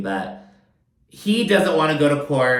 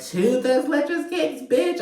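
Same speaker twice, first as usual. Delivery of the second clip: high, slow, mocking squeal; high mock outrage; sassy outrage; slower than usual. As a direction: camp it up like you're offended. Mocking outrage at catering choice.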